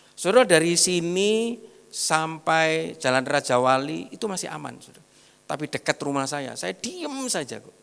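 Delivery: ordinary talk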